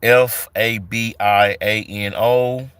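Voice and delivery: normal talking voice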